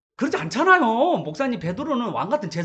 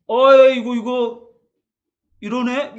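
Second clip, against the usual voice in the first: scoffing mechanic; 4 semitones higher, 9 dB louder, slower than usual. Acted. Pastor acts a proud mechanic.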